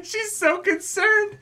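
very high squeal through laughter; helpless laughing. Real, not acted. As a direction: squeeze the words out slowly while cracking up. Laughing at a silly moment.

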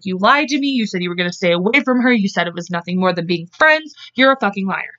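ordinary talk, nothing marked

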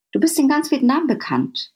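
usual speaking voice

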